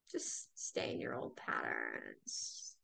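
low, slow tempting voice of the ego. Acted. Ego talking you into old patterns, low and slow. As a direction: drag it out like the lazy ego.

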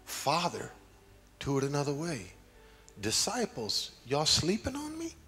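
high, pleading voice in prayer; acted plea, climbing high toward the end; Jesus. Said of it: "I thought about Jesus".

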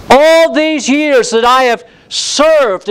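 high, slow, loud and resentful; brazen tone. Acted. Elder son throws his years of service back at his father, brazen and high. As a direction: lean hard on all these years. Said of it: "this brazen tone".

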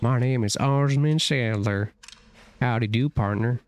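a slow, lightly hammed Southern drawl as the cult leader; mock southern accent. Mocking the cult leader's speech with a slow Southern accent. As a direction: play the villain as a cowboy, Southern twang. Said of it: "he has a southern accent".

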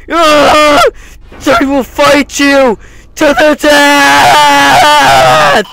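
high screaming panic; frantic screaming. Real, not acted. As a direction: scream at the monster.

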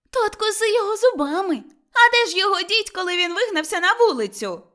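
high, loud, exasperated comeback; angry retort. Acted. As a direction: snap back at Motria, high and loud. Melashka retorts in quarrel. (обурена дзвінка відсіч)

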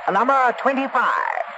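calling out. Acted. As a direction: announce the couple. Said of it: "called Raymond".

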